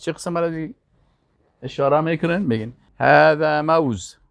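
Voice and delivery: ordinary delivery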